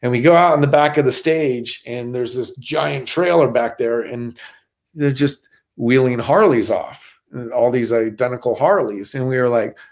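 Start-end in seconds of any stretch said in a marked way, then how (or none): none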